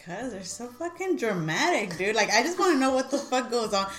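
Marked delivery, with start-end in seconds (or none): none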